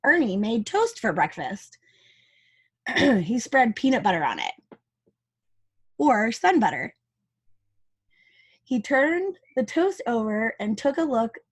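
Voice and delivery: ordinary delivery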